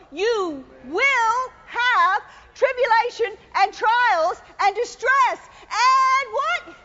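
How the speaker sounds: slow, rising call for the crowd to answer; slow call and response. Shown as a demonstration. Preacher leading the congregation in reading, slow and rising. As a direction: draw it out so they read along. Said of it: "Read that with me".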